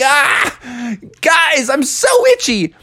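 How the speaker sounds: high, agonized itchy cry; frantic itching. Acted. Frog itching like mad to friends, high frantic cry.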